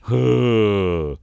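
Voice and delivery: loud, heavy sigh; exasperated sigh. Acted. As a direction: let out a big fed-up breath. Exasperated at losing composure.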